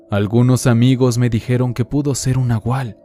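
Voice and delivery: ordinary delivery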